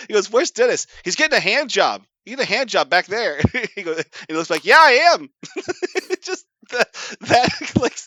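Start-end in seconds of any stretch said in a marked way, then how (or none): 0.3-3.4 loud, high mock yell; hammed-up loud heckle; imitated yelling. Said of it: "just start yelling". 4.6-5.3 loud, very high yelled reply; hammed-up high yell; mock shout. Re-enacts a shouted show line.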